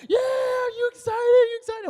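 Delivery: very high, loud crowd-of-friends shriek; excited yelling. Retold or imitated. Friends in honking cars hyping him up, excited yelling. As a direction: scream it like hyped-up friends.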